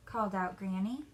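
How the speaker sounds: ordinary delivery, nothing marked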